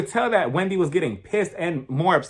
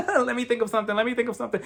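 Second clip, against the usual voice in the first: higher voice after a fake giggle; mock nervous laughter; 6 semitones higher, faster than usual. [name] buying time to prepare an answer, mock nervous laugh. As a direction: imitate stalling behind an uneasy laugh. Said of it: "that whole nervous laughter is just for you".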